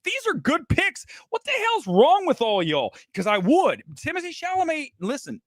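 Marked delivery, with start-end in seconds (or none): 0.0-3.0 high-pitched bantering challenge; playful mock protest. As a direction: tease a hostile crowd, voice pushed up. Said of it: "I'd be like".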